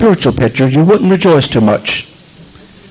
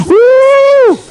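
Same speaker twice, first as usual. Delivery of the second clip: high exultant whoop; 3 dB louder than usual. Real, not acted. Preacher whooping for joy at Peter's faith, loud and high. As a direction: shout a joyful woo.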